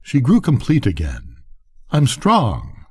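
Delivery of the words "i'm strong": a proud little boast, pitched higher; boastful. A narrator's reading. Elisa boasting to her husband, proudly. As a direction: lift it like a boast of newfound strength. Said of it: "she boasted".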